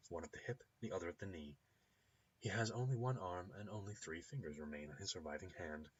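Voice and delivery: ordinary talk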